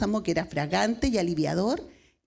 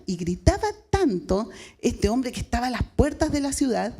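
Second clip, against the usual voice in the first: ordinary delivery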